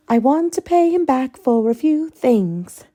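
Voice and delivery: high, eager sing-song voice. Acted. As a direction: lilt it like a giddy fanatic. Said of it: "Her sing-song voice".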